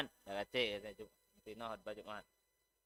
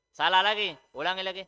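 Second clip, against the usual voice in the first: louder, higher acted teacher correction; acted teacher ordering a repeat; correcting teacher; 5 semitones higher, 8 dB louder, faster than usual. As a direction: call out the mistake to a pupil, firmly. Teacher acts correcting a pupil's reading.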